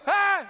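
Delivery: loud, high mock scream; panicked cry. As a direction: yelp in comic panic. Acted panic, retold.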